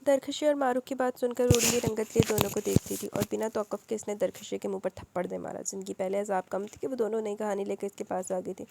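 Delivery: usual speaking voice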